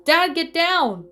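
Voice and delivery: high, loud, slow panicked yell; roaring. A narrator's reading. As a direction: scream a warning at dad. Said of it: "Drew roared".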